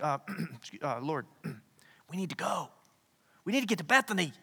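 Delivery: throat-clearing, timid interruption; hesitant disciples. Retold or imitated. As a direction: nervously clear your throat before speaking up. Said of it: "his disciples are like".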